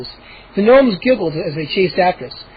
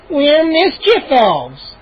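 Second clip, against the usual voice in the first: very slow, high gnome; 7 semitones higher, much slower than usual. Acted. Gnome explaining what they are. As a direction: announce it proudly.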